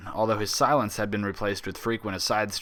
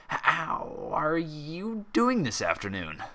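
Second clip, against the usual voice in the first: higher, very slow, forced friendliness; nervous fake cheer; 7 semitones higher, much slower than usual. A narrator's reading. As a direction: cover your panic with a fake smile. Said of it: "trying to hide his unease beneath a terribly fake smile".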